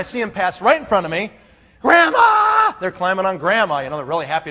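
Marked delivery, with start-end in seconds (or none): 1.8-2.7 put-on high, loud excited kids' cry; kids shouting. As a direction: yell it like kids running to grandma. Kids burst in running.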